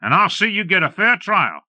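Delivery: loud, high call from the doorway. A narrator's reading. Ranger calling to the gunmen from the cabin, loud and high. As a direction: shout the order out to the men. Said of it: "he called".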